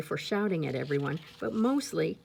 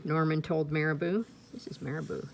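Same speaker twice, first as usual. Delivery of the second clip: usual speaking voice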